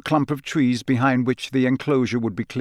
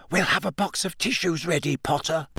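higher, hushed jeer; hissed taunt; 5 semitones higher, 4 dB quieter than usual. A narrator's reading. Students jeering at Harry as he passes, hissing. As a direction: taunt him under the breath, pitched up. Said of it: "or hissing".

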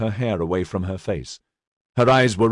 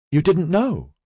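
higher voice, hopeful question; hope lifting the voice; rising in hope; 9 semitones higher than usual. Acted. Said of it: "Her voice had risen in hope".

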